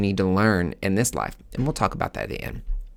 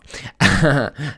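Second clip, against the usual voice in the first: a short burst of louder, higher laughter; real laugh; 5 semitones higher, 5 dB louder than usual. Real, not acted. Cracking up after insulting Queen Elizabeth, laughing out loud.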